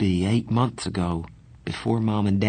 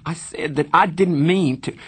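loud, high, near tears; frustrated; 8 semitones higher, 5 dB louder than usual. A narrator's reading. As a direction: shout it out in frustration.

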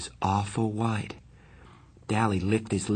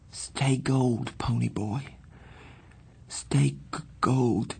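dying faint voice; 4 semitones higher, slower than usual. A narrator's reading. Dying boy's last words.

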